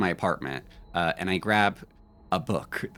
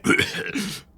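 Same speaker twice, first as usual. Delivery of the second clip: short mock cough after a smoke; acted cough; 14 semitones higher than usual. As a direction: cough to cap the apology. Acts choking on bong smoke.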